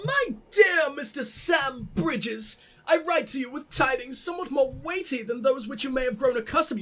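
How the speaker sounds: high, posh letter-writer's voice; lofty, posh formal delivery. Acted. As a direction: read it out in a grand posh accent. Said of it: "I gotta do the posh voice".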